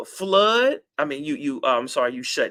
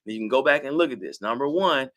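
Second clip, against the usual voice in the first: normal talking voice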